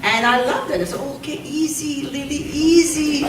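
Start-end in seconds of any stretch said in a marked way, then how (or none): 1.2-3.3 playful calming down. Retold or imitated. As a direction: rein in an over-keen helper, voice pitched up. Retold calming of strict Lily.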